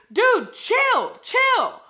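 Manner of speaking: high, loud "dude, chill"; exasperated yell at a book villain; mock yell. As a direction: shout at the character to calm down. Yelling at the character.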